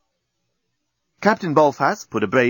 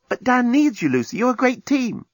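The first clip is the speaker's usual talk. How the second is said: higher, pleading; Nettie's voice; 12 semitones higher than usual. Acted. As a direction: try to persuade her.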